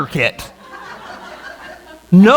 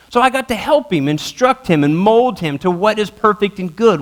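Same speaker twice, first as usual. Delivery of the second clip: normal talking voice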